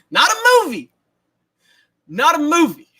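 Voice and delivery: high, loud mocking rant; loud incredulous quoting rant; Allen Iverson rant. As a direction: bark it like the famous press conference. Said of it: "the new Allen Iverson".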